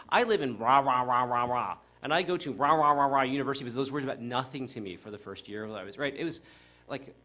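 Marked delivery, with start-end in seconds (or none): 0.1-3.6 loud, rapid mock recital of place names; rattling off names. People in New Jersey telling the newcomer where they live, mock loud chatter. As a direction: rattle off names that meant nothing to you. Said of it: "also people like".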